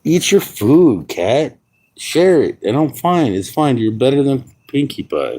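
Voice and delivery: pet voice. Real, not acted. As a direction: coax the cat high and sweet. Talking to the cat.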